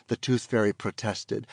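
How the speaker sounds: plain reading voice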